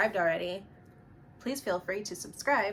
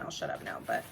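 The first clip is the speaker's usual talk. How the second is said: whispered aside; 11 semitones lower, 6 dB quieter, faster than usual. Real, not acted. Self-deprecating aside.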